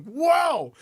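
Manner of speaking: high shouted whoa at the cold wheel; startled yell at icy cold. Retold or imitated. Touching the cold steering wheel.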